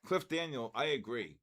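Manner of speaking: normal talking voice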